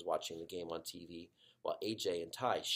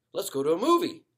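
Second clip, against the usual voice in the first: upbeat dad; 9 semitones higher, 12 dB louder than usual. Acted. Dad suggests brightly.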